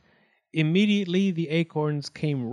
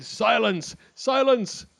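high commands, the second higher; shouting; 9 semitones higher, 3 dB louder, slower than usual. A narrator's reading. Wildcat demands silence in court, high shouting. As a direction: shout for order. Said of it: "Wildcat shouted".

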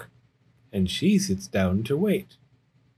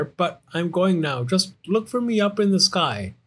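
high, excited bear cub; confident Little Bear; 8 semitones higher than usual. Acted. Little Bear leaving for the sky, high and confident. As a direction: play a young bear setting off boldly.

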